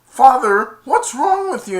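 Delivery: high and loud, upset; Jasmine pleading. Retold or imitated. Jasmine begging her father, high and loud.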